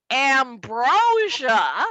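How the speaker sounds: slow, loud drawn-out name; proud dad. Retold or imitated. Dad revealing the special guest, slow and proud. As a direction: savor the band's name. Dad's punchline.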